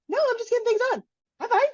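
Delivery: high, chirpy, breezy denial; mock innocent. To people calling her aggressive, a mock-innocent high brush-off. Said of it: "come off as aggressive".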